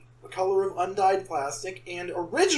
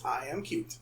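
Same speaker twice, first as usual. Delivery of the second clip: softly underplayed mock cat, low in pitch; cat impression; 7 semitones lower, 11 dB quieter than usual. Cat impersonating cats for co-hosts, soft mock cat. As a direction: keep up the quiet cat act. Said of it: "That's my cat impression".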